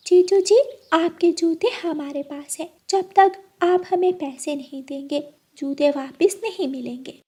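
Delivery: put-on high playful girlish voice; teasing little sister. Bride's sister teasing groom for shoe money, high and playful. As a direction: tease the groom cheekily.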